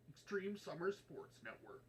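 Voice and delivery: hyped network name in trailer style; promo voice. Acted. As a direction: punch the title like a sports promo. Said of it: "Promo voice?".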